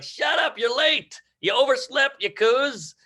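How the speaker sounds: high, mock-exasperated scolding. Scolding a coworker's excuses, high mock exasperation. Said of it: "And you're like".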